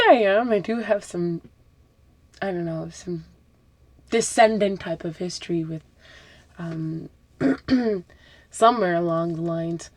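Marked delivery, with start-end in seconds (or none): none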